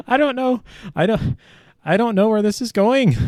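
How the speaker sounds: high, squeaky real laughter through the words; helpless laughing. Real, not acted. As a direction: lose it laughing at your own failed attempt. Cracking up at own failed impression.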